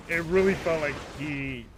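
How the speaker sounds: normal talking voice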